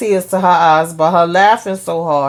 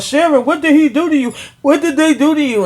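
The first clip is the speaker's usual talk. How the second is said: higher-pitched, urgent mock interrogation; insistent questioning; 9 semitones higher than usual. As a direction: act out Barry pressing her for answers. Retells Barry questioning Sharon insistently.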